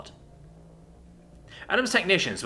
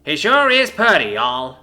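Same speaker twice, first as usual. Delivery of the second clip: exaggerated Georgia accent; 3 semitones higher, 8 dB louder, slower than usual. Price mocking Sykes in an exaggerated Georgia accent. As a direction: overdo a Southern Georgia twang, chortling. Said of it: "chortled an exaggerated imitation of Sykes' Georgia accent".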